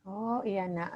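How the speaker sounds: normal talking voice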